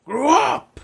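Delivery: high, loud imitated bellow; mock furious roar; retold yell. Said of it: "he just literally just yelled".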